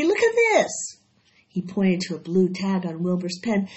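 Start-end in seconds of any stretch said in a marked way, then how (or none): 0.0-1.0 put-on high, loud boy's shout; excited cry. A narrator's reading. Avery spotting the prize tag, excited cry. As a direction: stop everyone with a shout. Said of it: "cried Avery".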